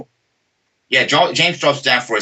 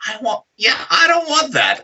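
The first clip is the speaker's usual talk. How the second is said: very high, pleading protest; mock dread; 13 semitones higher than usual. Friend scared off fighting after watching, mock high-pitched dread. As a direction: play a squeaky, reluctant refusal.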